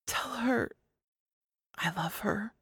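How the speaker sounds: soft, breathy whispered plea; hushed, intimate whisper; tender whisper. A narrator's reading. Tender message for his daughter.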